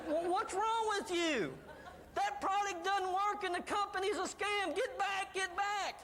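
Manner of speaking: high-pitched mock yelling from a crowd; acted yelling. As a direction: heckle in a high, scolding yell. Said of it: "start yelling at you".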